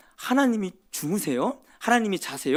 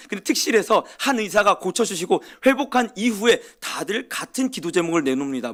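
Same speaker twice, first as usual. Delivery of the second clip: normal talking voice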